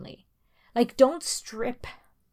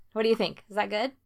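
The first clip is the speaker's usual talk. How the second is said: ordinary talk, nothing marked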